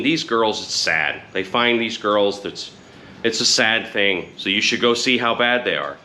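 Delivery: ordinary talk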